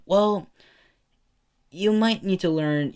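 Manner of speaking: high, loud mom voice; mother voice. Acted. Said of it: "She responds saying".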